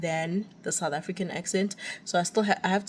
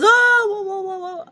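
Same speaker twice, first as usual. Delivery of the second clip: high, loud burst of sudden realisation; hammed-up high-pitched whoas; mock amazed reaction; 13 semitones higher, 7 dB louder than usual. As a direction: imitate a co-teacher's big "ohh" of understanding. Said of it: "And then she goes".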